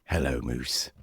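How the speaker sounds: low-pitched villain impression; Crowley. TV fan imitating a fictional wild card, low impression. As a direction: drop deep for a sly character greeting. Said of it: "Crowley from Supernatural".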